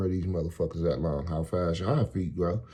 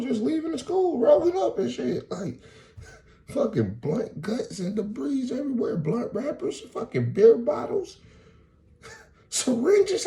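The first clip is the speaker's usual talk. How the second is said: pitched way up in disbelief; rising disbelief; incredulous pitch rise. Real, not acted. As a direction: voice climbs higher as outrage builds. Incredulous retelling of school scene.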